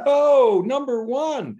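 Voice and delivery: high, loud burst of mock amazement; amazed exclamation. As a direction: imitate the stunned promo guy finding a number one record. Retold promo guy's amazement.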